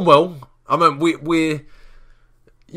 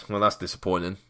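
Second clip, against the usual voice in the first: flat disappointment; 7 semitones lower, 7 dB quieter than usual. Real, not acted. Conceding a goal.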